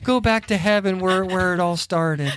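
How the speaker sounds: mock-mournful farewell; all broken up. Wife sending dead birds off, mock-heartbroken. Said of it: "just all broken up about it".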